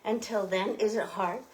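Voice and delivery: usual speaking voice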